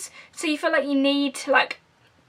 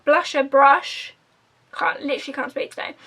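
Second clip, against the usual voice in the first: ordinary talk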